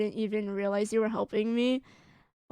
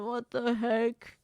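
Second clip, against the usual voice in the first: ordinary delivery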